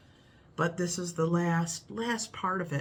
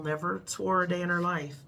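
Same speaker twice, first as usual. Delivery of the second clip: usual speaking voice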